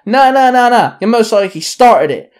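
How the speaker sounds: high, loud angry bark. A narrator's reading. Rival gang member barking at the narrator. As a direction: bark the accusation back. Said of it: "the guy barked".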